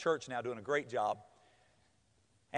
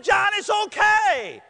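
high, loud, drawn-out strained wail; mock crying; 12 semitones higher, 6 dB louder, much slower than usual. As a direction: comfort him while pretending to be crushed. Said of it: "I'm crying 'cause I thought".

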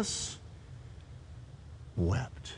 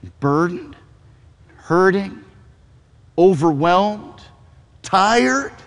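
softer, slowing to a breathed last word; gentle invitation; 3 semitones lower, 4 dB quieter, slower than usual. Retold or imitated. Pastor gives Jesus's invitation to the weary, soft and slow. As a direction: invite them into rest. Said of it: "Jesus says".

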